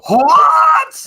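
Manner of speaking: very high, loud shout; yelled what. Retold or imitated. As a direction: snap it sharply. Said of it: "George go".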